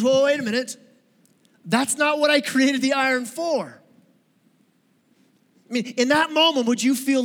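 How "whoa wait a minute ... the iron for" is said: higher, surprised protest as the inventor; an inventor stopping a misuse of his iron; Henry protesting. Retold or imitated. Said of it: "he goes".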